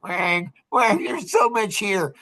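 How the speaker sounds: high, dragged-out whine; mock whining. Author mimicking people who complain about his workbook, whining. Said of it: "People are like whiners".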